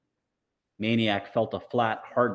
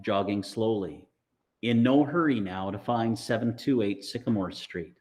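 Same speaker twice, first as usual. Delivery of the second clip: plain reading voice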